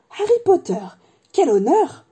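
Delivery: high-pitched, awestruck exclamation; awed delight. Acted. Old barman recognising Harry Potter, high awed delight. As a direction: marvel loudly at a celebrity.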